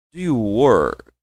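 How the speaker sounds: slow, drawn-out teacher demo; very slow model. Shown as a demonstration. Teacher slows the question right down for students, very slow. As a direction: stretch every word for learners. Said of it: "De novo, ó".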